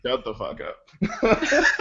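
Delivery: ordinary talk, nothing marked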